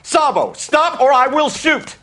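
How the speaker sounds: loud commanding yell; shouting. Acted. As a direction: bark the order at the gunman. Cop yelling at armed suspect.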